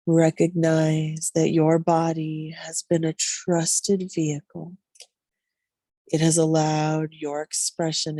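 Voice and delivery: slow, drawn-out meditation voice with long pauses; guided Yoga Nidra meditation. Real, not acted. Yoga guide leading listeners into deep rest, slow meditative delivery. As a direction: ease them into rest, stretching the words slowly.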